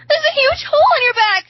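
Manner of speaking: high, loud horrified scream. A narrator's reading. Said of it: "she screams".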